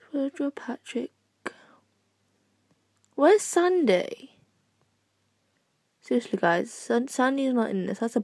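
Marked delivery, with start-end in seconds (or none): 0.1-4.3 higher, slow, searching question; surprised. Real, not acted. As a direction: drag it out in disbelief. Searching for missing character.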